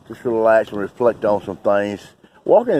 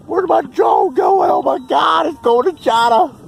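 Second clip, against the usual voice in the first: frantic yelling; panicked shout; 4 dB louder than usual. Real, not acted. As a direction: shout in real alarm as the drone vanishes. Drone flying away out of sight.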